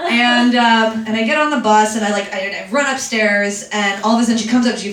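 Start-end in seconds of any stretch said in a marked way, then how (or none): none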